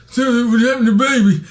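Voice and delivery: high, loud mumble rapper voice. Retold or imitated. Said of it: "this is my impression of mumble rappers".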